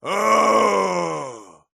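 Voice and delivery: drawn-out groan of agony; long groan. Acted. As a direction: groan long and let it fall away. Suffering before plea for release.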